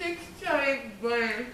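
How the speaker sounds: talking through marshmallows; mouth full. Real, not acted. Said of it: "and then you put them in your mouth".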